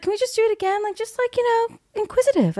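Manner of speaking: high voice imitating the director; lightly mock the director, high-pitched. Imitating the director asking for another take. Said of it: "he's like".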